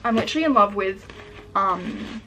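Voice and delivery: ordinary talk, nothing marked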